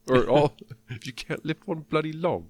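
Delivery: high-pitched mock Cockney; Michael Caine impression. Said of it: "That's my Michael Caine".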